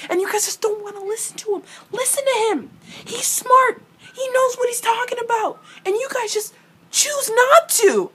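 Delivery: high, fast squeaky exasperation; exasperated high rant. Real, not acted. Fan ranting at characters who ignore Alec, high exasperated voice. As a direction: pitch it way up, losing patience.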